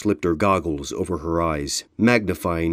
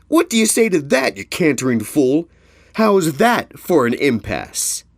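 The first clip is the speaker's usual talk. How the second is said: high, loud teasing, slowing at end; gloating; 8 semitones higher, 4 dB louder than usual. Acted. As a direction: rub it in playfully. Red Turnip gloats at Ironhoof.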